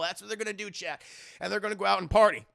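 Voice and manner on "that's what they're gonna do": ordinary delivery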